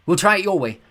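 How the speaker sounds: tense decision under attack; urgent. A narrator's reading. Girl tells Walt to light them, loud and urgent.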